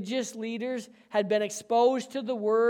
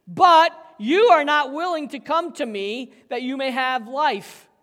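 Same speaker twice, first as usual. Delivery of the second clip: louder, higher, pressing every word; forceful reading; 5 semitones higher, 8 dB louder than usual. Shown as a demonstration. Pastor reading Jesus's words to the leaders, forceful and rising. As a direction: read it as Jesus confronting them, rising. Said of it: "listen to how he says this too".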